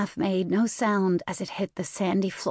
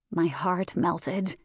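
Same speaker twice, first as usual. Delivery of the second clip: ordinary delivery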